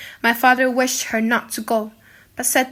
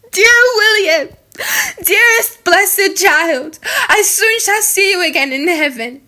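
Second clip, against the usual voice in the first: tearful farewell; 5 semitones higher, 5 dB louder than usual. A narrator's reading. Condemned girl speaks of dying.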